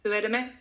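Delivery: "Hur är det med" merged into one short unit; quick unstressed "Hur är det med"; monotone, as one word. Shown as a demonstration. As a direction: say "Hur är det med" evenly, no stress, left hanging. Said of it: "very monotonous".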